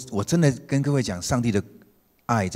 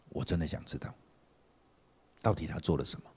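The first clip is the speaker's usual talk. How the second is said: much softer, gentle asking; tender; 5 semitones lower, 10 dB quieter than usual. Real, not acted. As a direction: ask it softly, sincerely. Pastor speaking tenderly.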